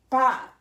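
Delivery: loud, punchy "bop" for a hit; one loud, short imitated blow; imitated smack. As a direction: punch out a sudden smack noise. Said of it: "I said".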